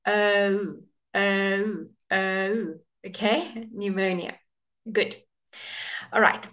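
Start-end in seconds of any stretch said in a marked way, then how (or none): 0.0-2.7 long "O" closing into a w; O with W glide. Shown as a demonstration. Teacher demonstrates the O sound, w at the end. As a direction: draw out each "O", rounding into w. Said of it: "like there's a W at the end".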